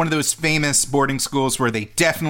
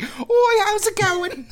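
high, chirpy English accent imitation; slower than usual. Old lady greeting a fan, in a high English accent. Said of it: "a regular English accent".

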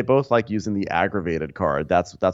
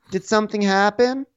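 higher, lifting on something; mock Melissa again; 13 semitones higher than usual. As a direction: push something up, even higher. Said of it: "Melissa's like".

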